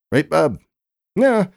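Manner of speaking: bright host toss. Acted. Announcer tossing to the sports guy.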